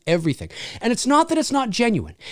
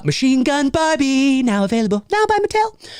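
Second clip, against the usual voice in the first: ad voice, pitch jumping high on Mattel; mock toy commercial; 6 semitones higher than usual. Selling a fake toy, hammed-up ad voice.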